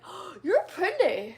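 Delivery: put-on high, loud, drawn-out kid's gush; imitating a kid's admiring squeal; excited kid. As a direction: gasp it out like an amazed child. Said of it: "I love when kids be like".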